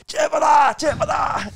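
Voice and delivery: shouting. Retold or imitated. Retelling kids chasing a streaker.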